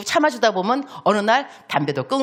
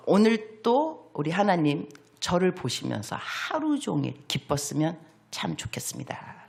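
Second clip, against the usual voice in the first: whispered prayer; 5 dB quieter than usual. Acted. Quoting his own prayer.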